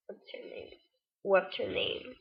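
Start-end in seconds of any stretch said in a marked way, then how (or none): 0.1-0.7 hushed, barely voiced mock question; much softer, almost voiceless retelling; imitated hard-to-understand question. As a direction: mumble it very softly, imitating him. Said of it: "I can't understand his accent".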